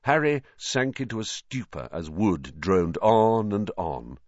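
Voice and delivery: usual speaking voice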